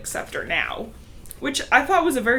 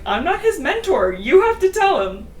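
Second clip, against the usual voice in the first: higher, louder retold protest; mock cat reply; 8 semitones higher, 5 dB louder than usual. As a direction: toss it back, a bit indignant. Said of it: "And he's like".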